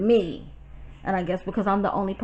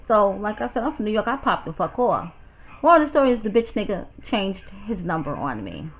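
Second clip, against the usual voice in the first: ordinary talk, nothing marked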